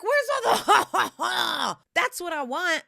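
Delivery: laughing, slow, loud mock demand; hammed-up frustrated plea. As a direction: shout it through a laugh. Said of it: "I'm like".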